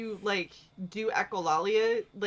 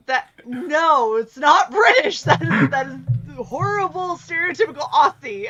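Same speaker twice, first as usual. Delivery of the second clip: exasperated; 6 semitones higher, 7 dB louder than usual. Real, not acted. Friend's terrible British accent.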